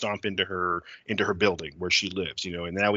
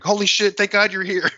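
relieved scared cry; 11 semitones higher, 7 dB louder than usual. Pretend. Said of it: "Allison is gonna be like".

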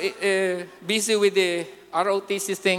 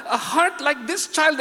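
ordinary talk, nothing marked

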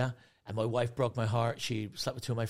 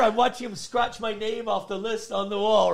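usual speaking voice

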